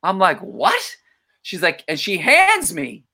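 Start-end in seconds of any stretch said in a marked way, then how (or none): 0.4-1.0 high, incredulous yelp; startled, high-pitched question; retold disbelief. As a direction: squeak it out in mock shock. Shocked at banned word list.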